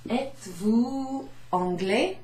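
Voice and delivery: Êtes-vous anglais said slowly with a rise on anglais; slow model question. Shown as a demonstration. Teacher drilling the class on Êtes-vous anglais. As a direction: model the French question carefully. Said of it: "teacher models the question".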